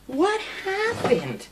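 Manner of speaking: very high, slow drawn-out coo; cooing to pet. Real, not acted. Owner asking her trembling bird what scared it, high slow coo. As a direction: ask it tenderly, way up high and slow.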